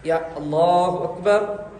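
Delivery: Allahu Akbar stretched long, Akbar rising; teacher repeating the takbir slowly. Shown as a demonstration. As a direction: linger on each syllable of Akbar. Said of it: "तकबीर औरतें इधर बांधेंगी ऐसा".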